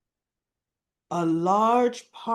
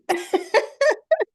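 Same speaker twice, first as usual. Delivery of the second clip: laughing cry; 13 semitones higher, 5 dB louder than usual. Real, not acted. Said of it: "I started crying too".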